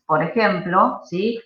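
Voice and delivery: ordinary talk, nothing marked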